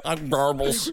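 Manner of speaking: mock gum-in-mouth mumble; mouth full. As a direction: say it like your cheeks are stuffed. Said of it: "with a bunch of gum in your mouth".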